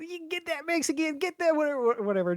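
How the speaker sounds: high, goofy shout of orders; dumb gamer yelling. Retold or imitated. Same gamer voice repeated.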